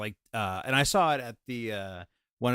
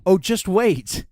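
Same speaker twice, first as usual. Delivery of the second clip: higher, louder, drawn-out tease; gleeful teasing anticipation; 7 semitones higher, 8 dB louder, slower than usual. Retold or imitated. Boyfriend hinting that a surprise is coming, gleefully teasing. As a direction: stretch it out like a promise. Retells teasing before the reveal.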